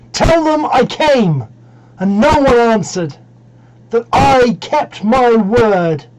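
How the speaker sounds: high, loud, drawn-out cry; shouted call. Acted. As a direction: call out slowly and forcefully to unseen listeners. Traveller shouts to the house.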